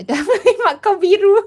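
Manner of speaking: gleeful, can't stop laughing; real laughter at the chat; laughing delight. Real, not acted. Reading a funny chat name.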